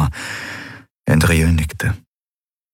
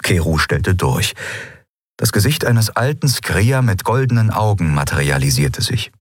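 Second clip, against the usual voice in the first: ordinary delivery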